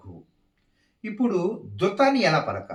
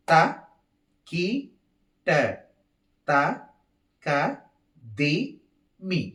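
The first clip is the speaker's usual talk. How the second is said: counted rhythmic syllables. Shown as a demonstration. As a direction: separate beats with even, roomy pauses. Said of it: "కలిపి చెప్తున్నాను".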